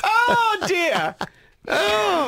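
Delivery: high, squeaky laugh-cry; laughing oh dear. Real, not acted. Host cracking up at the nasty shout-out, squeaky laugh-cry. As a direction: wheeze it out while crying with laughter.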